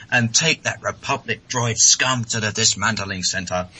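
a lightly put-on Gunray, commanding; mild hammed-up Gunray sneer. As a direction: bark the order like a pompous viceroy. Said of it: "And then Gunray goes".

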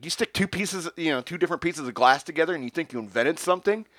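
high, loud mock tirade; railing rant. Ranting at Ben Franklin in front of the French guests. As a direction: rant at him, building. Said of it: "continued railing after him".

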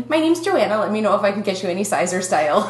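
chirpy, fast Minnesota store clerk; Minnesotan retail voice. Retold or imitated. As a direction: lay on the Minnesota accent like a Gap employee. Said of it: "almost bordering on Wisconsin there".

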